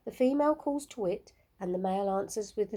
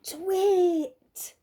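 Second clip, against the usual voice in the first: high, louder owl call; owl twit call; 9 semitones higher, 6 dB louder than usual. A narrator's reading. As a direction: chirp it out like an owl. Olive's call heard again.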